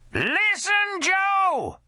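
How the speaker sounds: put-on high slurred drunken yell; drunk woman shouting. A narrator's reading. Drunk Scamala hollering at the bartender Joe. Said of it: "slurred Scamala Crash".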